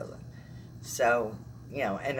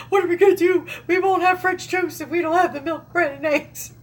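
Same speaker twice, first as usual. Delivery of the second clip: put-on loud, high, frantic South Philly accent; 7 dB louder than usual. Vlogger mimicking a viral Philly guy's rant, loud high South Philly accent. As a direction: rant like a panicked South Philly guy about milk and bread. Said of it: "he goes in this South Philly accent".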